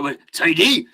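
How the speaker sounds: put-on Welsh lilt, rising on "tidy"; Welsh accent. Streamer joking that Mount Teede is pronounced tidy, mock Welsh. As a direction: play it very Welsh with a growl. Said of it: "when you do the Welsh".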